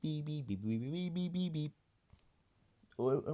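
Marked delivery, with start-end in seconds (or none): 0.0-1.7 high, soft beep beep imitation; playful high horn noises. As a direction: mimic a silly car horn. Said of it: "it's just the horn".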